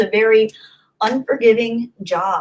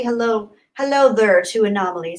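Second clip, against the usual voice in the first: normal talking voice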